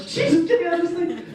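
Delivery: high desperate cry to get out; half-laughing panic while standing up; frantic plea. Retold or imitated. Unbuckling at last, high frantic plea. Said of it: "I'm like".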